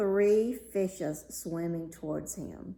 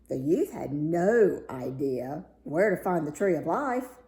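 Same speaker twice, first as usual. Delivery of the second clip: ordinary delivery, nothing marked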